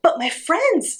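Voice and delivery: high, pleading little parrot; high-pitched, anxious protest; worried parrot. A narrator's reading. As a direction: plead in a high small-bird voice. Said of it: "said the parrot".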